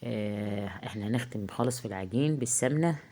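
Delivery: ordinary talk, nothing marked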